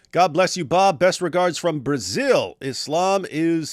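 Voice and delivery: showy high reading, last words hugely drawn out; super chat read-out. Acted. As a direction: stretch "is" very long before the final word. Reads super chat theatrically.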